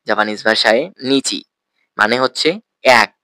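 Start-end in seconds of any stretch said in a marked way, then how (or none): none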